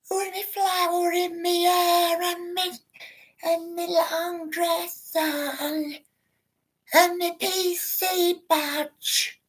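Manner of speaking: very high, very slow, sugary mock voice; drawn out, high, prissy as a flower girl; prim sweet lady caricature. Mocking the polite woman critics expect, high and slow sugary.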